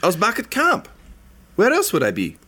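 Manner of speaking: high put-on gnome voice, eager; gnome NPC voice. Peachtree explaining herself to the party, high and eager. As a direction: answer quickly, a bit too eager.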